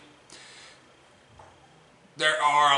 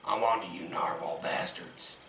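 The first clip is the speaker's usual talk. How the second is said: hissed paranoid threat; menacing whisper; 7 semitones lower, 13 dB quieter than usual. Acted. Paranoid rant.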